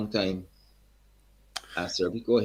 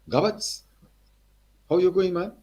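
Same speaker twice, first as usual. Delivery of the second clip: put-on high, hearty Guyanese accent; 9 semitones higher, 4 dB louder than usual. Eddie's father greets the young singer in a high Guyanese accent. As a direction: greet him like an old Guyanese man. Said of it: "Strong accent again".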